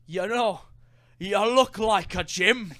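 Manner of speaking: a hint of a jolly hammered Irishman naming the baby; Irish cleric voice. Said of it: "it was an Irish cleric".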